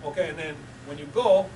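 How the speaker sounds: ordinary delivery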